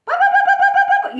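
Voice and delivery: very high, loud squawking; nonstop hen chatter. Retold or imitated. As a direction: squawk like a hen that can't stop. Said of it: "those hens that can't stop".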